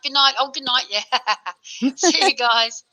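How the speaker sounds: normal talking voice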